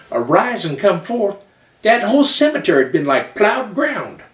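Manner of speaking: Southern preacher building to a high, loud peak; Southern pulpit fervor, rising to full force. Acted. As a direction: preach to a rousing climax in a Southern accent. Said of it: "but they talk like this".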